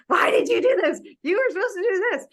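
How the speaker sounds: high, loud pretend scolding; mock yelling. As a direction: scold like a mean colleague, hammed-up. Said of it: "she yells at me".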